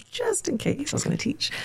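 ironic self-mockery; mock high ironic aside. Shown as a demonstration. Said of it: "just in case I was going to teach".

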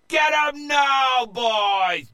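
very high, loud slow shout; battle cry. A narrator's reading. Captain crying out to his pirates in battle. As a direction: charge them with a yell. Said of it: "Joe cried out".

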